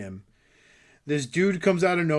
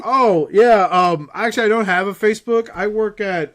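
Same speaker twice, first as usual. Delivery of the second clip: loud, high, stalling cover story; awkward excuse; 7 semitones higher, 10 dB louder than usual. Retold or imitated. Cornered shopper dodging the seller's questions, awkwardly loud. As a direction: blurt it out, buying time.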